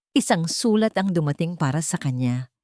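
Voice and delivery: ordinary delivery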